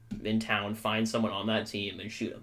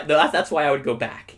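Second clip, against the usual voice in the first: usual speaking voice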